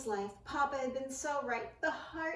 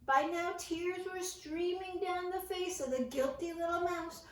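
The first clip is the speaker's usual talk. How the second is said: ordinary delivery, nothing marked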